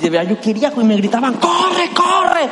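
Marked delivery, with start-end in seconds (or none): 1.3-2.5 crowd yelling. Retold or imitated. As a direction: shout it like fans in the stands.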